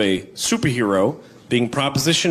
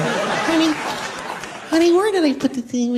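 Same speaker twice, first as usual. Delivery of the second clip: much higher, reedy call; annoying reedy voice. Retold or imitated. Partner after three months calling honey, high reedy voice. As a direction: go thin and pinched like a nagging partner. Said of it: "annoying, reedy thing".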